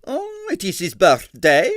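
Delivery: high, slow curious diva; interested. A narrator's reading. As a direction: perk up with interest.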